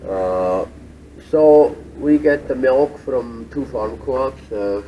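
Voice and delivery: loud over the whey pump; talking over machines. Real, not acted. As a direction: project over the factory floor. Speaking over the cheese vat machinery.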